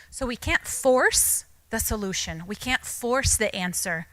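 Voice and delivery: slow, firm, with force and can't weighted; stress on force. Real, not acted. Therapist explaining to parents, slow and firm. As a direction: lay down the principle slowly. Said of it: "stresses the point slowly".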